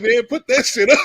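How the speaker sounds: mock command breaking into laughs; laughing retold quote. Retold line, laughing with friends.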